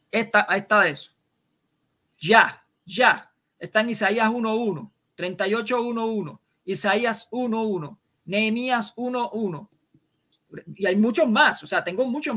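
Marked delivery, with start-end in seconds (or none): none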